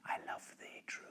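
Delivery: breathless whisper, barely audible; faint whisper. Acted. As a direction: breathe the words to him like a secret. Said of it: "in language strange she said".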